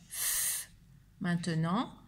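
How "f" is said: "F" as voiceless friction only; soft, held "fff"; isolated F sound. Shown as a demonstration. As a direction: breathe out the sound of "F", not its name. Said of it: "la lettre qui faisait le son f".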